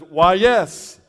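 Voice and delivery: loud, slow booming voice from heaven; hammed-up heavenly voice; voice of God. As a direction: announce it like God from above. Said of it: "that voice comes from heaven".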